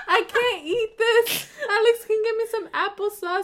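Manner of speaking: hammed-up old-lady complaint; toothless old lady. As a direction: whine like a grandma without her teeth. Imitating toothless elderly self.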